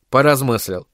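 usual speaking voice

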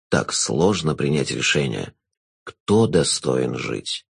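low, slow, velvety jester; sinister jester. Acted.

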